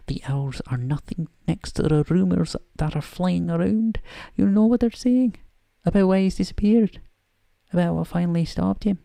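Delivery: put-on a slightly overdone Scottish accent, briskly; Scottish McGonagall. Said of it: "almost bad Scottish accent".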